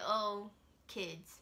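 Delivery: ordinary delivery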